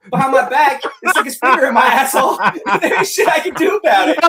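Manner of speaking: high, loud, helpless cackling; real laughing fit; laughing while talking. Real, not acted. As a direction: barely get the joke out through laughter. Joking, both men cracking up.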